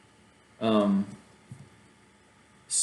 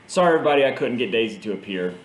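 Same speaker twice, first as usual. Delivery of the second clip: ordinary talk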